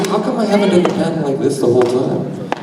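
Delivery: ordinary delivery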